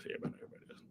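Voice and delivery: sly whispered repeat; whispered echo. Real, not acted. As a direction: whisper it back to yourself. Whispered echo of previous line.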